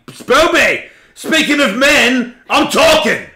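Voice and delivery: loud, high shout to silence a pet; yelling at dog. Real, not acted. Host yelling at the barking dog while he talks. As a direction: bark the dog's name to cut it off.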